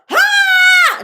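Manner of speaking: a sudden high, loud barked ha; mock shout. A shocked reader mimicking the client's high, loud "Ha!". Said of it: "it wasn't like a".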